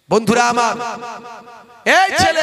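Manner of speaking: high, loud sermon cry; full-force preaching. Acted. Preacher crying out to the young men, full force. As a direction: call out to the crowd like a preacher.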